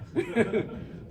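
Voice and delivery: bursting laugh after the impression; cracking up at the memory. Real, not acted. Teacher laughs at his own gaffer story, bursting laugh.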